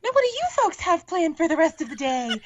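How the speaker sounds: high, sweet princess voice. Retold or imitated. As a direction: chirp it like a perky Disney princess.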